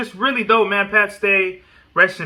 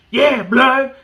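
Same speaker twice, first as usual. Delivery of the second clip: higher, louder mock gang shout; 6 semitones higher, 5 dB louder than usual. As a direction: bark it like a hyped rapper on live. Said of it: "remember when six nine was like".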